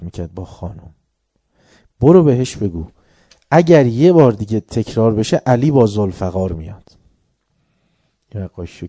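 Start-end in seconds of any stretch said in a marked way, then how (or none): 2.0-7.0 stern warning. Retold or imitated. Ali's stern warning to a harasser.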